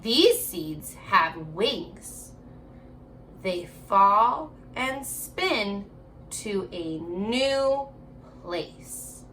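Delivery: ordinary talk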